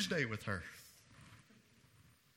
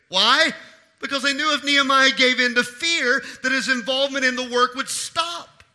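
preaching; 6 semitones higher, 13 dB louder than usual. Acted. Preacher rises again.

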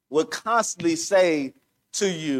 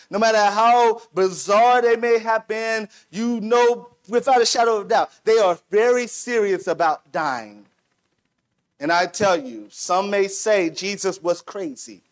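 fervent preaching. Real, not acted. Pastor driving home Christ's passion to the church, fervently. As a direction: preach it with building fire.

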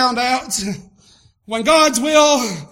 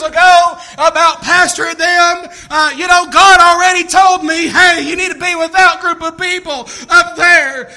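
loud preaching testimony; sermon testimony voice; 8 semitones higher, 10 dB louder than usual. Real, not acted. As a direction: tell it to the church with fire. Preaching at full force.

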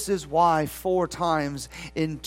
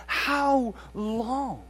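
with passion; 4 semitones higher, 3 dB quieter than usual. Shown as a demonstration. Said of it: "read it with passion, with despondency".

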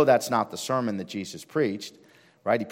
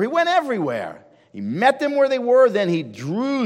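louder, higher, rousing preacher's call; preaching at full force; 3 semitones higher, 3 dB louder than usual. Acted. Pastor urging his church to go out, rising and loud. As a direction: drive the point home to the pews.

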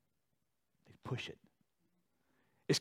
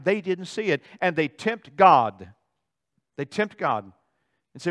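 normal talking voice

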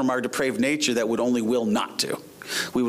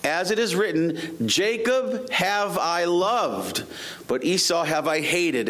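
raised, declamatory Bible reading; scripture reading; 5 semitones higher than usual. Acted. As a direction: proclaim the verse to the congregation, drawing out key words. Reads scripture aloud.

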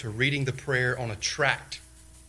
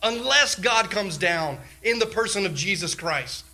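raised, urgent preaching voice, pitched higher and louder; full-force preaching; 8 semitones higher, 4 dB louder than usual. Acted. Preacher urging the ladies toward God's holiness, fervent. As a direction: drive it home from the pulpit.

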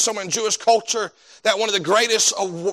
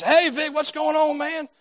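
much higher, cheery hallway hello; retold friendly greeting; 10 semitones higher than usual. Preacher greeting Vic in the hallway, much higher and cheery. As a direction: greet him brightly so he feels welcome.

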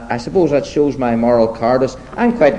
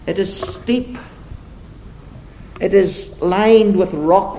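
measured, emphatic sermon delivery with deliberate pauses; preaching; 6 semitones higher than usual. Acted. Preacher setting two roads before his congregation, preaching. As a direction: preach it slowly, leaning on the key words.

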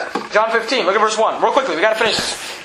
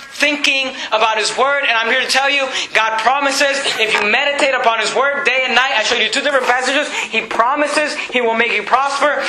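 higher-pitched, insistent preacher's cadence; fervent preaching; 6 semitones higher than usual. Real, not acted. Preacher telling the congregation what God promises, fervent. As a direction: drive each promise home from the pulpit.

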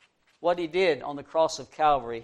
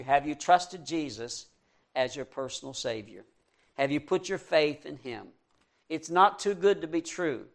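ordinary talk, nothing marked

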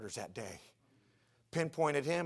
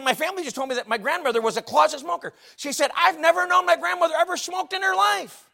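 loud, high-pitched sermon storytelling; full-force preaching; 13 semitones higher, 13 dB louder than usual. Real, not acted. Pastor retells a granddaughter's questions at a funeral, preaching loudly.

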